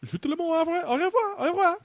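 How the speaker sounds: put-on high squeaky French accent; french chef. [name] saying goodbye.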